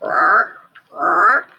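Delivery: hammed-up froggy roar; frog croak. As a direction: frog calling to squirrel. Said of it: "said Frog".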